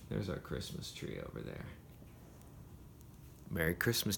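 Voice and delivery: plain reading voice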